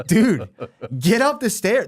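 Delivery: put-on high-pitched exasperated dad urging kids upstairs; impatient, nasty parent. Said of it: "really impatient and really nasty".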